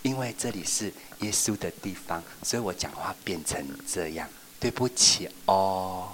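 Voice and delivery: gentle whisper-soft sorry; hushed apology. Shown as a demonstration. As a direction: speak softly for church.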